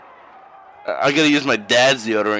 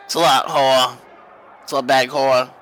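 smoker voice. Acted. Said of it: "I don't know why I sound like a smoker".

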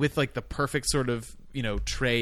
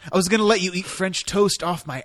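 mock spurned lover; 5 semitones higher, 5 dB louder than usual.

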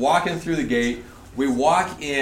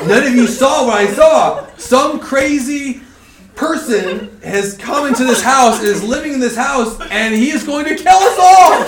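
raised, loud voice climbing to a yell; building panic; 9 semitones higher, 7 dB louder than usual. Retold or imitated. Pranked friend explaining his fear afterwards, building panic. Acting out terrified friend's account.

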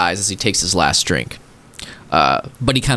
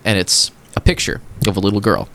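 normal talking voice

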